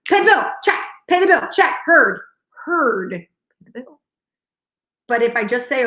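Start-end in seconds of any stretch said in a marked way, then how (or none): 0.1-4.0 high call-outs falling to normal pitch; lightly mimicked restaurant-kitchen acknowledgement; kitchen call-back. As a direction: echo the order like a line cook. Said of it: "I do the Hell's Kitchen thing".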